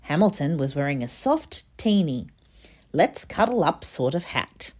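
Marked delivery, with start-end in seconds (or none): none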